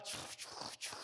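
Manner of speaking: whispered chu chu chu of a creaking ride; rattling ride noise. Retold or imitated. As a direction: mimic the old ride shaking, softly. Father imitates the rattling ride. (imitando en susurro el traqueteo de la atracción)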